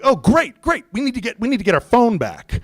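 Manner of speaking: high, gushing retold eagerness; excited relief. Owner talking to the driver who has his phone, excited. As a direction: pounce on the good news.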